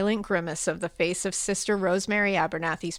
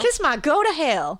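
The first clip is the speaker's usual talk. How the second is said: higher Southern twang on the insult; sling it out in a southern accent; twangy Southern put-down; 7 semitones higher, 4 dB louder than usual. Acted. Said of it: "is a real southern insult".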